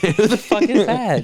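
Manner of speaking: high, drawn-out disbelief; real incredulous outburst; exasperated bafflement. Real, not acted. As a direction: demand it, voice jumping up in bafflement. Baffled by a repeated obscure name.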